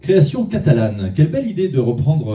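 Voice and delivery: usual speaking voice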